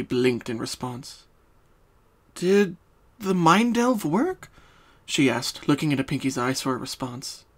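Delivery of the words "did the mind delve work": raised, gently concerned mare; Twilight's voice. Acted. Twilight checking on her dazed friend, concerned.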